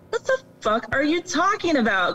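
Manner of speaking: high-pitched baffled disbelief; mock incredulity. Average person hearing of dog trafficking, mock incredulous.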